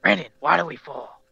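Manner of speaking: Batman impression. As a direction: ham up the caped crusader's growl. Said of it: "I'm gonna do my best Batman impression".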